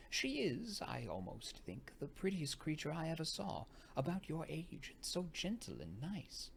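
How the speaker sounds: high, gushing praise of the guest; lightly voiced governess, delighted; fond older lady. A narrator's reading. Madame praises the guest fondly.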